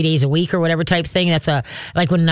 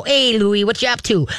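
raised, friendly hello to a dog; happy dog talk; 5 semitones higher than usual. Shown as a demonstration. Trainer as visitor greets Louie, talking happy. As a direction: greet the puppy happily, pitched up. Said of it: "talking happy to him".

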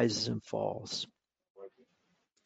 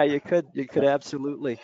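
normal talking voice

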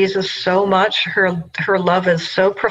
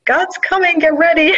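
high, lightly hammed-up herald voice; mock divine announcement; 11 semitones higher than usual. Podcast guest playfully voicing God's herald, high mock proclamation. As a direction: announce it like a grand heavenly herald.

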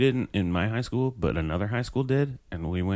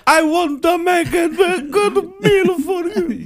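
high, loud, hammed-up wail; mock sobbing plea; 10 dB louder than usual. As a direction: wail it slowly like a heartbroken waiter. Poor waiter pleading, voiced as sobbing.

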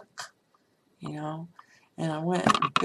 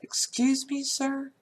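pointed, higher-pitched objection; indignant retort; 8 semitones higher, slower than usual. Retold or imitated. Customer answering the man who called her little girl, indignant. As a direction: pull him up sharply, pitch lifted.

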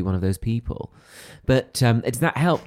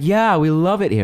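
American fan gushing; 7 semitones higher, 6 dB louder than usual. Retold or imitated. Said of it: "And she's like".

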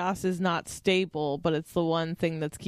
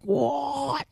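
imitating Andrew; 8 semitones higher, 4 dB louder than usual. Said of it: "he's like".

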